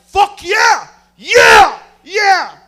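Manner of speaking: high, very loud goal cheer; celebratory shouting. Retold or imitated. Watching a close World Cup game, shouted celebration. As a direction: bellow like your team just scored.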